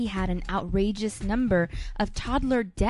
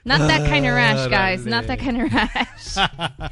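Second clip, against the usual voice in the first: laughing protest; 5 dB louder than usual. Real, not acted. Denying the rash joke.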